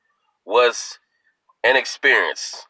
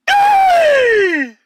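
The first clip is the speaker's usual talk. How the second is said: a single, high, piercing holler; one loud holler; 7 dB louder than usual. Retold or imitated. Aunt hollering once at the funeral, piercing loud holler. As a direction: holler it loud enough to stop the room. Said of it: "She went like this".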